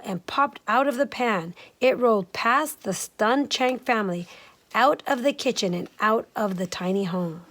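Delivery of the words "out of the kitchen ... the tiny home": ordinary delivery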